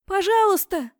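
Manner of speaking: put-on very high, loud child's plea; little girl calling. A narrator's reading. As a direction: call out pleadingly like a small child. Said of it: "звала из-за щита маленькая девочка". (тонкий детский зов)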